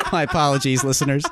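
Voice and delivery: apologizing through laughter; laughing. Real, not acted. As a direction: still giggling as you apologize. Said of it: "Nick's cracking himself up".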